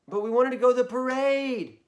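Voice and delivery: put-on high, loud whining child; whiny protest. Ryan protests to Mom about the parade, whining. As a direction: wail it like a let-down kid.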